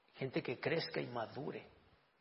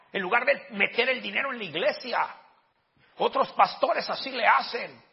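much higher, much louder indignant complaint; critics complaining; 7 semitones higher, 13 dB louder than usual. Retold or imitated. Pastor voicing critics who complain about his spending, loud and indignant. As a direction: rant like an outraged church member. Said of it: "Hubo gente que me criticó".

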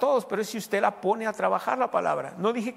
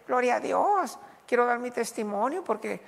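mock testimony; 4 semitones higher than usual. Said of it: "algunos cristianos dicen".

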